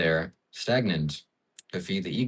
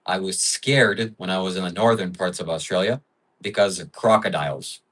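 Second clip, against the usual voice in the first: normal talking voice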